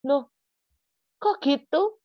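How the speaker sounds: high, loud mock disbelief; taken aback. Preacher speechless at God's answer, high-pitched bafflement. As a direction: re-enact a baffled squawk of protest. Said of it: "langsung saya speechless Bapak Ibu".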